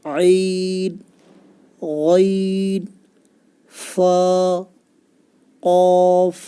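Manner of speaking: each Arabic letter name drawn out slowly and clearly, with a pause after each; slow letter recitation. Shown as a demonstration. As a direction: recite the alphabet slowly for a child to copy. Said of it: "सही पढ़ना है". (हर हर्फ़ धीरे और साफ़ बोलें)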